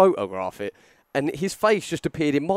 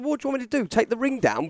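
mock exasperated retort; 10 semitones higher, faster than usual. Retold exasperated reply.

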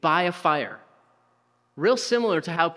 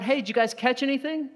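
high voice calling out across the water; 9 semitones higher, faster than usual. Retold or imitated. Stranger on the shore calls to the fishermen, high and carrying. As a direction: call to the boat from the shore.

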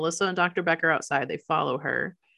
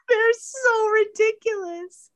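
much higher and slower, a dumb cop gushing; mock dopey cop voice; 13 semitones higher than usual. Cop voice mocking how silly the cops are. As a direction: ham up a clueless cop.

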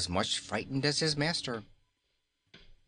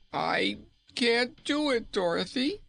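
near weeping; 13 semitones higher than usual. A narrator's reading. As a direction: plead on the edge of tears. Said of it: "almost weeping with despair".

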